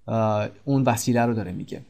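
usual speaking voice

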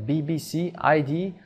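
بی بی سی، آی دی said letter by letter; name each letter of بی بی سی، آی دی; separate letters. Shown as a demonstration. Teacher recapping for learners, each letter read separately.

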